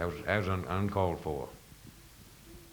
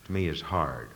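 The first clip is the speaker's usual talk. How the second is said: ordinary delivery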